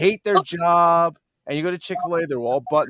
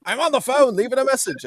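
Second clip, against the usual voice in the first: high-pitched retold interruption; Gates calling out; 11 semitones higher, 3 dB louder than usual. Friend cutting in on a voice-message chat, mock high call-out. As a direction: imitate a friend piping up, high. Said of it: "then I hear Gates go".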